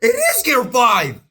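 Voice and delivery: high-pitched shout of disbelief; astonished. Real, not acted. Fan recognising Gear five, astonished shouting. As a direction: cry out in sudden realisation.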